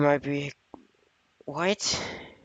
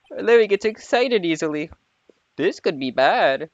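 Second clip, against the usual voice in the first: character voice; 5 semitones higher, 3 dB louder than usual. Acted. Voicing a game character.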